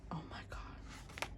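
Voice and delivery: slow, very soft whisper; awed whisper. Real, not acted. Fan stunned by the photo book, awed whisper.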